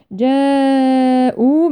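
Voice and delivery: slow chanted جاءوا with a long-held aa; connected elongation. Shown as a demonstration. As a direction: hold the alif of جاءوا for several beats. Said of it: "متصل أربع أو خمس حركات". (مد الألف في جاءوا مدا طويلا)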